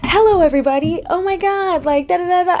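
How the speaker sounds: high, bright on-set host voice; bubbly commercial persona. Retold or imitated. Showing how she plays upbeat on a commercial set, high and peppy. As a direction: switch on the peppy pitch. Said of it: "get on a commercial set and be like".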